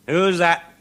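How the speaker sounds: loud, high call through the door; porter's challenge. Acted. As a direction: bark it out from behind the gate.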